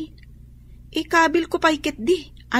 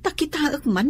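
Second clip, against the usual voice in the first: usual speaking voice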